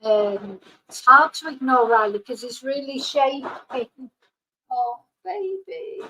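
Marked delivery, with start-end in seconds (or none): 4.7-6.0 soothing dog. Real, not acted. Comforting shaking dog.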